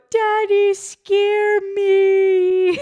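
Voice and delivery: high, loud crying toddler imitation; hammed-up weepy toddler. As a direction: imitate a sobbing little girl. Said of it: "she go".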